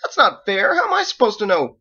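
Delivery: put-on high, loud, wounded boy voice; boy protesting. Edward protests to Michael, high and loud. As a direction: cry out at the injustice.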